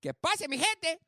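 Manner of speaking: hammed-up Spanish greeting; Spanish accent.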